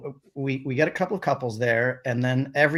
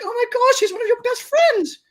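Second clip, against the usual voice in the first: very high, louder mock British outrage; British wife; 7 dB louder than usual. Wife scolding husband over friend, high mock outrage. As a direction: squeal it like the appalled wife. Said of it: "she was like".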